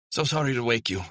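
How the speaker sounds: high-pitched, lightly voiced educated Scottish woman; educated Scottish accent. A narrator's reading. Woman apologises to the man who opened up, educated Scottish accent. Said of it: "Her accent was educated, Scottish".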